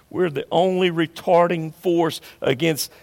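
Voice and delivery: ordinary delivery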